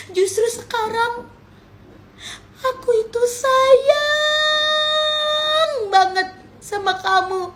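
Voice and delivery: to her twin, slow and weepy; fake tearful confession. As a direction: squeeze out false tears.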